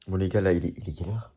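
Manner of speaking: low, quiet, worn-out voice; exhausted. Real, not acted. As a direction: mumble like you're dead tired. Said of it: "Je suis mort".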